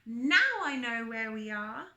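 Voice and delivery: loud, slow triumphant announcement. A narrator's reading. Rabbit announcing to friends, loud slow triumph. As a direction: crow it out like Rabbit winning. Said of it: "Rabbit said triumphantly".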